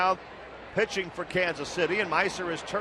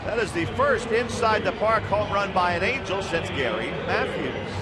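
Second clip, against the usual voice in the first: excited play-by-play; 3 semitones higher than usual. Real, not acted. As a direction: announce a rare feat to the crowd at home. Announcer marks rare home run.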